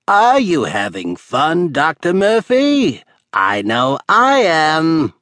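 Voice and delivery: villain gloating from afar, booming; booming taunt. Acted. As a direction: boom it out across the gorge, gloating. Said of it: "Methuselah's voice boomed out".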